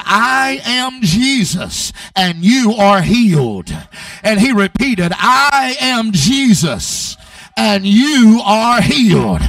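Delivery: loud, slow, solemn declaration; Jesus' proclamation. Retold or imitated. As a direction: proclaim each word slowly with full force. Preacher voices Jesus' words.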